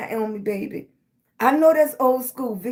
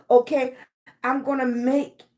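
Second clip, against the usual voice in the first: ordinary talk, nothing marked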